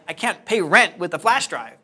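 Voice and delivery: loud, exasperated retort; exasperated protest. Retold or imitated. Broke man to the guy paying him in a flash drive, loud exasperated protest. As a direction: protest it loudly in mock disbelief. Arguing back over being paid nothing.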